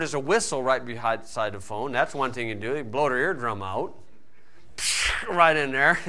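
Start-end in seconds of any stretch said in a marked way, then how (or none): 4.0-5.2 soft laugh. Real, not acted. Laughing at his own joke.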